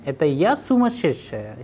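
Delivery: я pushed up as a challenging question; incredulous question leaning on я; interrogative intonation. Shown as a demonstration. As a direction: ask it with strong stress on я. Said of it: "using the intonation".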